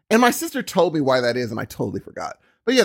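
ordinary delivery

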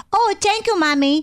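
put-on high sweet toddler voice; little kid voice. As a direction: play a grateful toddler. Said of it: "He'll look at me and go".

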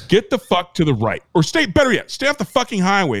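normal talking voice

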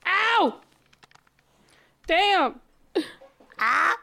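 sharp high yelp of pain, overplayed; dramatic ow. Real, not acted. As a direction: cry out ow, Damn, Ah like you've been stung. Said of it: "That was dramatic".

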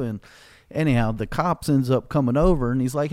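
ordinary talk

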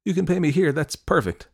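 high-pitched, over-bright recovery; nervously helpful teenage girl; flustered cover-up. A narrator's reading. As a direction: pitch it high, then ease down. Flustered girl covering her slip.

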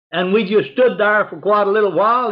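ordinary talk

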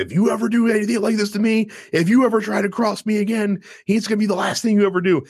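fast, high mock scream of a threat; retold threat. Strowman threatening Triple H in the corner, mock high threat. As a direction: spit out the warning fast and high. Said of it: "screams at him".

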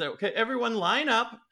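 high guide calling out; high-pitched character instruction; Gallagher's voice. Acted. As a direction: herd students in a high voice. Game master voices Gallagher.

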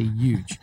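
"huge" pronounced "yooj"; Long Island "huge", opening on a Y. Shown as a demonstration. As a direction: leave the H off "huge". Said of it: "I can't pronounce the H".

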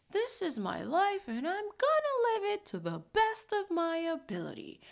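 high, hammed-up yolo bravado; mock carefree. Herself excusing the spending to viewers, in mock high bravado. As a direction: pump yourself up, half joking.

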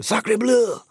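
sacre bleu shouted up high, mock French; big mock-French sacre bleu; hammed-up French exclamation. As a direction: throw it up high like a cartoon Frenchman. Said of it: "Who said Sacre bleu?".

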